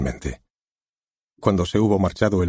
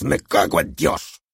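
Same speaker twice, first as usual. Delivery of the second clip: lightly voiced director; 2 semitones lower than usual. Director conjures the opening scene for the author, lightly voiced. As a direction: paint the scene, drawing him in.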